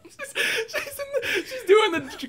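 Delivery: words breaking up in high-pitched laughter; real giggling fit while trying to talk; helpless laughing. Real, not acted. Riffing on her absent accent coach, cracking up.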